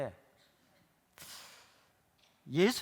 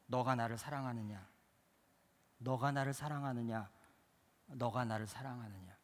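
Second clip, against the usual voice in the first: lower, quiet probing; soft repeated question; 5 semitones lower, 5 dB quieter than usual. Acted. Jesus quietly asking the pastor, low and soft. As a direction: ask it softly three times.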